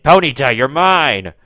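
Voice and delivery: loud triumphant call; excited shout. Real, not acted. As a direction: claim it with a loud cry. Excited trying to catch.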